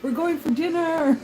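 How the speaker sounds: put-on high, loud whoop of joy, like a delighted kid; excited cheer. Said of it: "And he's going".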